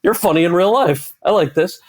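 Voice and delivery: high, loud, comically taken aback; impressed surprise. Retold or imitated. Actor reacting to the writer's witty comeback, acted surprise. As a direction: back off in high-pitched amused amazement.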